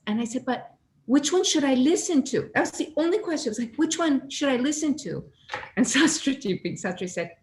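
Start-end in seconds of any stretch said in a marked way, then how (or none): 0.4-2.5 eager question. Retold or imitated. Asking palm reader for guidance. 3.8-5.2 eager question. Retold or imitated. Asking palm reader for guidance.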